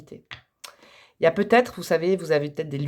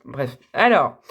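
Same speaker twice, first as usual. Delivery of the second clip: ordinary talk, nothing marked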